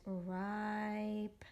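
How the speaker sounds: "ripe" stretched with a long held I; decoding demo. Shown as a demonstration. Teacher reading the base word, "ripe" drawn out. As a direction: sound out "ripe" slowly on an even tone. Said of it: "I'm gonna read it as".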